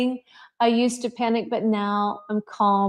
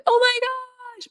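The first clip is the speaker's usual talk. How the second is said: slow, much higher, mock-panicked gasp; 13 semitones higher, slower than usual. Teacher imitates herself panicking first, mock alarm. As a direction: play up your own panicking self. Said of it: "I'm kind of a".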